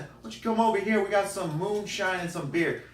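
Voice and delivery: high, quick imitated Southern invitation; Southern accent. Neighbor offering moonshine and beer, high Southern accent. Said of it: "Real Southern accent".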